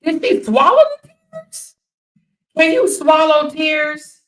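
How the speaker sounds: high, slow mocking questions; sarcastic disbelief. Real, not acted. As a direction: needle her with mock wonder. Mocking fake crying.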